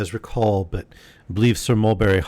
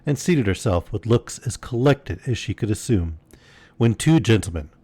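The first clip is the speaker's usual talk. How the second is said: usual speaking voice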